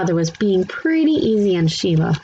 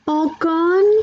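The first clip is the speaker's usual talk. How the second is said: much higher, small questioning rise; toddler voice; 11 semitones higher than usual. A narrator's reading. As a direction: ask like a tiny puzzled boy. Toddler Fudge asks.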